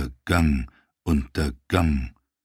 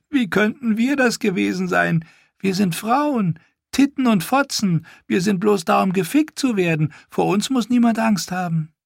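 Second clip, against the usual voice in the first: much higher, louder sneering woman's voice; mocking washerwoman; 4 dB louder than usual. Holly sneering at Theon, high and mocking. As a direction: taunt him with sweet cruelty.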